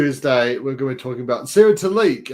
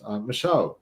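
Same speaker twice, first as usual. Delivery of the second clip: normal talking voice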